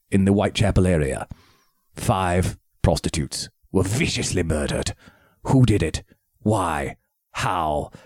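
deep movie trailer narrator; low dramatic trailer announcer; Beauregard trailer voice. Pretend. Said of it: "using my trailer guy voice".

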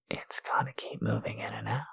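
soft unvoiced whisper. Shown as a demonstration. Teasing partner whispers the instruction. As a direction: breathe it as a whisper. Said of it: "repeated aloud".

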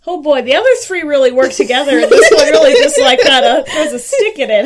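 loud laughing delivery. Real, not acted. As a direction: laugh through the words. Laughing at odd option.

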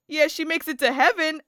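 high, loud mocking punchline; smirking husband. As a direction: tease it through a smirk. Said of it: "with this smirk on his face".

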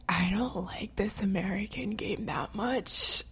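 whispered, slow confession; whispered aside. Retold or imitated. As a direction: whisper it like a secret. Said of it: "I'm just, like".

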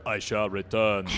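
stiff formal. Retold or imitated. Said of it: "what would you guys prefer".

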